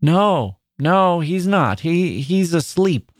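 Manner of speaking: put-on mother telling her child the father is only asleep; faltering mother. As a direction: hesitate as you hide the truth from her. Mother hides death from child.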